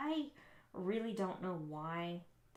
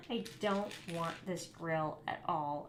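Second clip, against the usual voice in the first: normal talking voice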